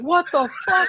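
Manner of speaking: high-pitched, laughing incredulity; mock disbelief. Acts out his stunned reaction.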